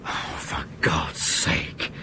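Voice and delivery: slow, breathy whisper under the breath; exasperated whisper. Acted. Captain scoffs quietly at the spy ship theory, whispered. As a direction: mutter it to yourself in disbelief.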